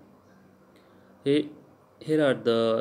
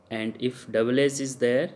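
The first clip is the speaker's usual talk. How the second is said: usual speaking voice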